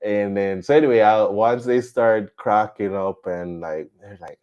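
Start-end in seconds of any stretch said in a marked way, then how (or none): none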